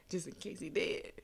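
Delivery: nervous whisper; hushed fearful aside; whispered worry. Real, not acted. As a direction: whisper it so the monster can't hear. Nervous about the shark returning.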